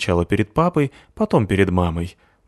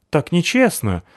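bewildered; 9 semitones higher than usual. A narrator's reading. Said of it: "растерялся кот".

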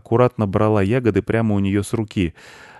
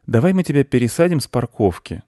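put-on high boy's question; high-pitched young boy asking gently; little boy; 6 semitones higher, 4 dB louder than usual. A narrator's reading. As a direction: ask in a high child voice. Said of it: "сказал мальчик жукозявке".

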